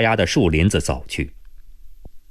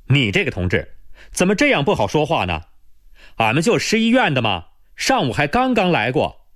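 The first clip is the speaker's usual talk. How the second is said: raised-voice protest; 6 semitones higher than usual. A narrator's reading. Hospital woman reasoning with the sentry, raised-voice protest. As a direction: protest to a stubborn sentry, pitch lifted. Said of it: "李桂兰大声说道".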